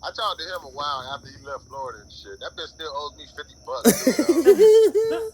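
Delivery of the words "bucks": high, loud, slow, laughing through it. Real, not acted. Joking that Boogie still owes him money, laughing. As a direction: squeal it out mid-laugh.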